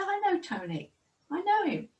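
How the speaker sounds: high, eager burst of recognition; excited recognition. Retold or imitated. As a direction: jump up high, delighted to know him. Man recognizes friend's name.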